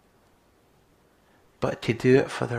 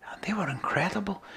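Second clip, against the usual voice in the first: awed whisper; 6 semitones higher, 6 dB quieter than usual. Real, not acted. Sudden whispered awe.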